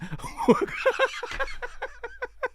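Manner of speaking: shrill squeal of laughter at the joke; high squealing laugh. Real, not acted. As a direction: burst of high giggling.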